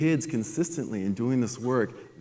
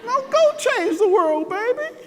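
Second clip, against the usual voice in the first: proud mama; 7 dB louder than usual. Retold or imitated. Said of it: "It's like".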